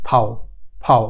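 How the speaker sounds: ফাও said as the German V; teacher modelling ফাও for learners; German letter sound. Shown as a demonstration. German V letter name. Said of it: "ভি এর উচ্চারণ".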